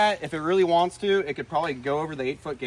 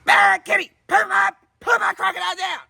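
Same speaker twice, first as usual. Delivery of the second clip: high, loud scolding of a bad cat; mock scolding shout; 6 dB louder than usual. Host yells at an imagined bobcat through the speakers, high mock scolding. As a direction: yell the cat off the croc.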